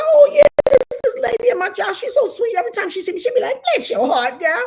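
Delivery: high, bubbly, clueless retelling; naive gushing self. Retold or imitated. Streamer playing her naive younger self, high and gushing. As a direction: gush high and sweet, not getting it. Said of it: "I was like".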